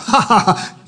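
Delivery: retold mocking laugh. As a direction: laugh off the devil's claim. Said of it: "He started laughing again".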